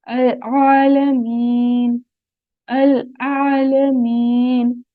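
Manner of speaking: slow measured Quranic recitation. Shown as a demonstration. Teacher slowly recites words for students to copy. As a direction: recite each word slowly and clearly.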